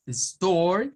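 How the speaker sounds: American store; rhotic store. Shown as a demonstration. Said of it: "repetimos estos".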